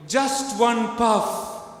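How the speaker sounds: high, slow, drawn-out call; called-out provocation. Shown as a demonstration. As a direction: call it out teasingly, not yet at full force. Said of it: "Louder, meaner".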